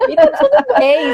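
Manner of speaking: high reassuring echo; a sudden high, upbeat it's all fine. Real, not acted. Repeated reassurance, said emphatically.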